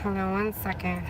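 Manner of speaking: breathless from running back in; out of breath. Real, not acted. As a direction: talk while catching your breath. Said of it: "Oh, I'm out of breath".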